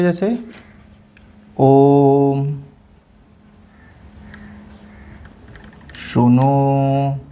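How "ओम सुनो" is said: ओम and सुनो held very long; drawn-out examples. Shown as a demonstration. Teacher demonstrates long vowels in examples. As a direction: stretch each vowel to three beats. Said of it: "example में देख लीजिए".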